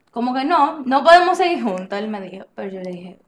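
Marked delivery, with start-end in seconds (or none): 0.1-2.0 louder, higher quoted breakup line; retold firm refusal. Retelling boyfriend ending the relationship, loud and emphatic.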